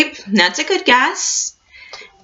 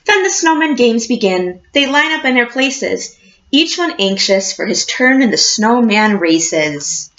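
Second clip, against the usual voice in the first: put-on reading to children. A narrator's reading. Teacher reading a picture book to her class, storybook voice.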